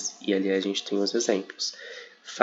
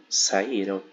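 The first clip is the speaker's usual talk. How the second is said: ordinary talk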